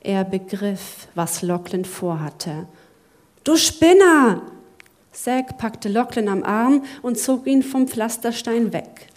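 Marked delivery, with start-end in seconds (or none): 3.4-4.4 loud, slow angry outburst; angry shout. Acted. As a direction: yell it at a reckless friend. Zack scolds Lachlan in alarm.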